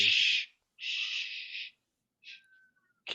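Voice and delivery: repeated hushing sound; shushing. Acted. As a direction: hush them with drawn-out shushes. Player shushes companions in game.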